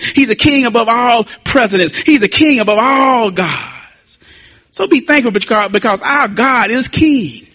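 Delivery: rhythmic, building sermon cadence; fervent preaching. Real, not acted. Preacher proclaiming God king above all, fervent preaching. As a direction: hammer each repeated phrase home to the congregation.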